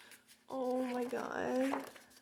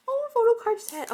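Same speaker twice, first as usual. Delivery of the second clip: much higher and louder burst of delight; thrilled cry at the photo cards; excited squeal; 11 semitones higher, 9 dB louder than usual. Real, not acted. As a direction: squeal it out when you spot it. Excited discovery while unboxing.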